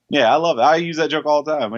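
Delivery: normal talking voice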